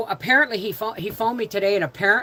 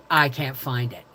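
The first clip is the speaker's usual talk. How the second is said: normal talking voice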